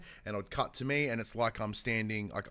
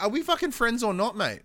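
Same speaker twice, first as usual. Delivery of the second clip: much higher, louder fed-up demand; exasperated shout; 10 semitones higher, 6 dB louder than usual. Retold or imitated. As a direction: yell at the fickle blacksmith. Exasperated player to blacksmith.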